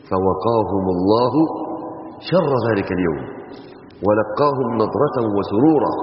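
slow, low melodic recitation; measured tajweed reading; Quran recitation. Acted. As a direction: recite it slowly as from the mushaf.

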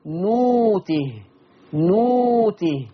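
نوته recited very slowly, waw in place of the hamza; نوته بإبدال الهمز واوا. Shown as a demonstration. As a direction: stretch نوته slowly, no glottal stop. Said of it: "تقرأ".

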